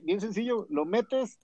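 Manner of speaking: usual speaking voice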